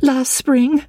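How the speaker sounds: high, agitated pleading; worked-up, high-pitched distress; overwrought insistence. A narrator's reading. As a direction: insist high, stumbling over the words. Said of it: "with clenched fists and repeated".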